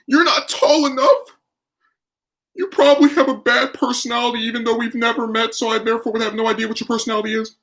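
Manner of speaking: high, fast mocking villain voice; anime villain. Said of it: "talking like an anime villain now".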